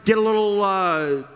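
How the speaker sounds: mocking heckle. Continuing Elijah's heckling.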